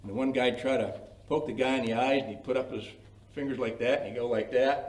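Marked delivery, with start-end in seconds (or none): none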